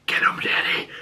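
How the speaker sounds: voiceless imitated child's whisper. Imagined daughter urging Frank on, whispered. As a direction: whisper it like a kid in dad's ear. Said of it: "Don't have his kids whisper".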